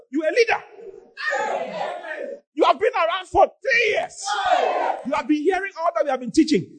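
high, exasperated preacher's rebuke; scolding. Real, not acted. Preacher to a long-time church leader, exasperated scolding.

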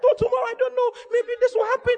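very high-pitched mock worrier; mock doubter. Preacher mimicking doubts about tomorrow, high mock doubter. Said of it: "stop talking like that".

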